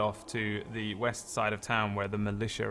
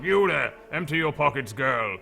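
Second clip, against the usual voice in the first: loud, higher guard order; guard's command; 3 semitones higher, 5 dB louder than usual. Acted. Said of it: "they're like".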